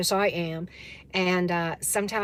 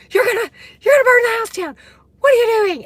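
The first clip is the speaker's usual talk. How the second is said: alarmed yell; 14 semitones higher, 11 dB louder, faster than usual. Retold or imitated. Friend yells about stove fire.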